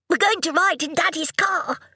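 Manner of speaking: put-on high-pitched gleeful little boy; crowing. A narrator's reading. Son about to ride with his father, crowing. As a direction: crow it like a thrilled child. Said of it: "crowed Oscar".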